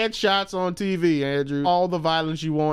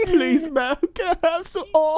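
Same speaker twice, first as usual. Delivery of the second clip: mock British kid; 12 semitones higher than usual. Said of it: "[name], say something".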